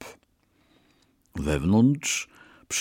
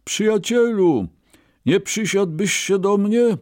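high, projected invitation; calling out; 12 semitones higher, 3 dB louder than usual. A narrator's reading. Brahmin hails rich merchant at the teahouse, calling out. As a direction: hail someone across a room, pitched high. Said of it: "zawołał".

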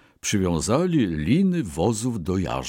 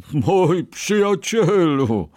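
high, loud, drawn-out mirth; shaking with laughter; 9 semitones higher, 5 dB louder, slower than usual. A narrator's reading. Merchant mocking the Brahmin's failed ox, helpless with mirth. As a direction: stretch the words with amused glee. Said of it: "trząsł się ze śmiechu kupiec".

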